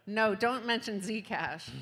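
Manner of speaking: normal talking voice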